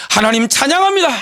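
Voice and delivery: loud, rising cry of praise; fervent praise. Acted. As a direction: call it out like a congregation praising. Preacher acts out fervent praise.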